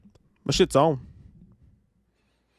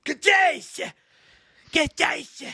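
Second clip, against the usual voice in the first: high-pitched strained mock yell; high cartoonish imitation; mimicking a movie line; much slower than usual. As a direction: yelp it high, aping the trailer. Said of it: "where he's like".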